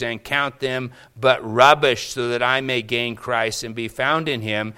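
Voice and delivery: loud, building scripture proclamation; fervent preaching. Acted. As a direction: preach it out with full conviction. Reads Paul at full force.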